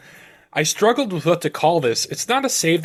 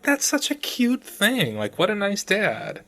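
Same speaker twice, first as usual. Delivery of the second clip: charmed; 8 semitones higher, slower than usual. Retold or imitated. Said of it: "And I'm like".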